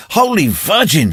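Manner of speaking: high frightened cry; shrieking. A narrator's reading. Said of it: "shrieked the children".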